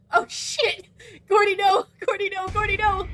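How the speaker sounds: high, loud panicked yelling; panicked shout. Real, not acted. Streamer yells at teammate Gordy in high panic. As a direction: cry out as a teammate is caught.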